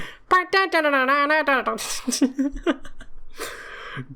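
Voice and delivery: high bouncy hummed chase melody; hummed Benny Hill tune. Pretend. Player imitating the comic theme, hummed high. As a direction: hum the silly chase tune through laughter. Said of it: "Benny Hill theme start playing".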